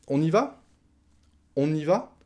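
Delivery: "On y va?" high, climbing on "va"; rising question tune. Shown as a demonstration. Asking the partner to set off, rising question. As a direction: lift "On y va?" sharply into a question. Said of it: "je monte le ton".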